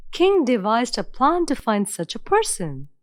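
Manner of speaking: ordinary delivery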